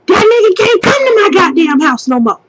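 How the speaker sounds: high, fast mocking of an angry mom; mom's rant quoted. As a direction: mimic mom going off over the phone. Retelling mom's call while half asleep.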